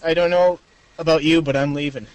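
usual speaking voice

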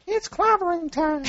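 high squeaky action figure voice; pull string toy. Retold or imitated. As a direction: toy voice box catchphrase. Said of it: "holding up the Thing doll and goes".

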